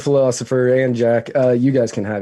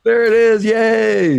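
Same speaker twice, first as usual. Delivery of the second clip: high, loud mock celebration; excited cheer; 11 semitones higher, 5 dB louder, slower than usual. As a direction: cheer like the crowd. Cheering along with co-host.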